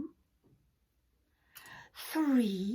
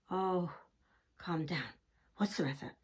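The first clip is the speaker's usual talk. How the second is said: soothing; 7 semitones lower than usual. Acted. Farmhand soothing the hen.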